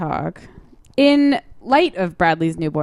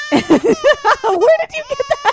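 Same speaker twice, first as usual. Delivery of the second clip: high, crying-laughing squeal; laughing shriek; 5 dB louder than usual. Real, not acted. Host surprised by cohost's sound effect, shrieking laughter.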